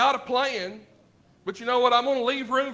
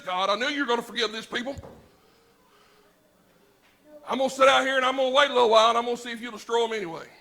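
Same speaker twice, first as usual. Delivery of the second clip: fast, louder, indignant rant; mock sulking Jonah; 5 dB louder, faster than usual. Jonah complaining to God, a fast indignant mock rant. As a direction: rattle it off like a pouting prophet.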